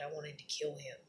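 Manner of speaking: breathy whispered fury, low; hushed angry whisper about wanting to kill him. Real, not acted. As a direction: hiss it under your breath, seething. Venting fury at husband, hushed.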